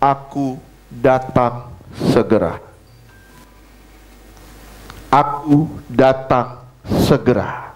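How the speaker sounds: slow, spaced-out divine announcement; each word set apart, solemnly slow; voice of God. Retold or imitated. God speaking to the preacher in prayer, slow and deliberate. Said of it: "saya dengar jelas Tuhan bilang".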